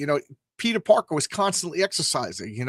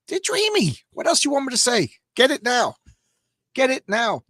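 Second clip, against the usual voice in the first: mock swooning pitch; 10 semitones higher than usual.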